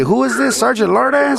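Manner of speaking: loud, high-pitched mock questioning; comic movie-quote outburst; Cheech. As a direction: pitch it way up, taunting the officer. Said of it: "What did Cheech say?".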